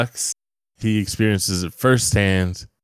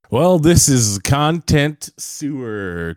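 slow, raised announcer intro; show intro; 6 semitones higher, 3 dB louder, much slower than usual. Acted. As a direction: drawl the show name like a host.

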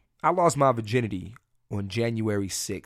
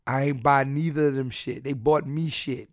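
ordinary talk, nothing marked

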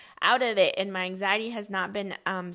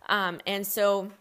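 ordinary talk, nothing marked

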